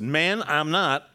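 sharp loud protest, drawn out slow; loud denial. Acted. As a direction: bark the denial back at the accuser.

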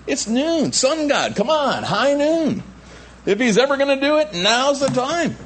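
high, jeering challenge; Elijah mocking. Prophet daring the sun god's followers at noon, high mocking. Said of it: "and mocking them".

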